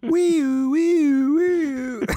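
high, loud mouthed police siren. Pretend. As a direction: wail it out like a cop car. Police siren after edgy joke.

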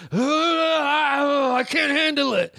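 very high mock wail; hammed-up meltdown, wailed in falsetto; imitated woman's yelling. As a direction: mimic a woman losing it. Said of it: "it's a woman's voice yelling".

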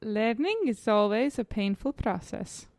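put-on slow, careful accent that drifts toward Indian; accidentally Indian-sounding accent. Player acting film quote for guesser, Indian-sounding accent. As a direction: attempt the accent slowly, landing near Indian. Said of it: "This is gonna sound Indian".

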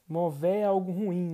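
ordinary talk, nothing marked

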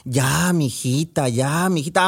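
slow, gentle mother's hush; soothing mother. Acted. Mother calming her daughter shouting in her sleep, gentle.

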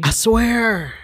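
high, loud I swear; emphatic agreement. Real, not acted. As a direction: say it like you mean it. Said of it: "agreeing emphatically".

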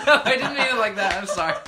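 loud, high, real laughter through the words; laughing apology. Real, not acted. Host to co-hosts after a misunderstood remark, laughing apology. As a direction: apologise while cracking up.

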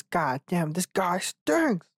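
high, very slow cartoon sponge voice; SpongeBob voice. Joking about a bad first blowjob, SpongeBob voice. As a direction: stretch it out like a goofy cartoon sponge. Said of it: "I wanted to do it in the SpongeBob".

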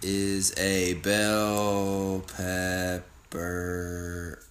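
very slow, low monotone, each word stretched; talking while typing. Real, not acted. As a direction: drag out each word as you type it.

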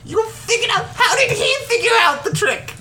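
high, sing-song game host voice; squeaky showman welcoming the players; bright squeaky sing-song welcome. Acted. NPC host voice again.

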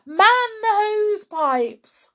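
high, loud cry for help; high-pitched emergency call. A narrator's reading. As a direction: shout urgent orders, stretching the last ones. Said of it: "cried Missus Cranky".

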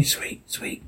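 distorted ghostly whisper. Acted. Daddy's warped voice luring Jane, whispered. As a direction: breathe it out, eerie and warped. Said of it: "Dad distorted voice".

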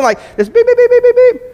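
high loud mock beeping; shrill electronic beeping; car beep imitation. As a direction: squeal the warning beeps. Imitating car warning beeps.

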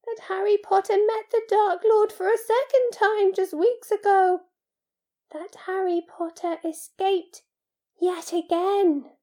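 high-pitched elf recounting in awe; hoarse, awed elf. A narrator's reading. House-elf recounts Harry's escape, awed and slightly hoarse. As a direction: start loud and ease off into wonder. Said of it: "he said hoarsely".

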